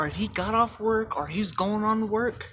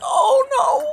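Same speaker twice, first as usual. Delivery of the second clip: laughing squeal; 9 dB louder than usual. Real, not acted.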